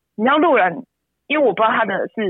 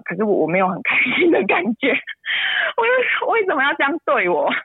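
crying; 5 semitones higher than usual. Real, not acted. Mother crying about her son's rejection, sobbing. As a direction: sob breaking into a pained cry.

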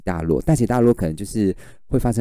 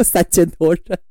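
normal talking voice

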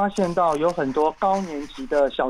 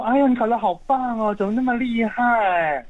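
higher-pitched, gushing parent; proud parent cooing over good marks; happy praise; 6 semitones higher than usual. Acted. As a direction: praise a child with delighted amazement. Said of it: "可能就会很开心".

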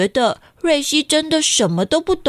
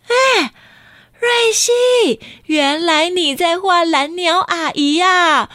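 gushing aunt; 7 semitones higher, slower than usual. A narrator's reading. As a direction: squeal over the drawing. Aunt gushing over drawing.

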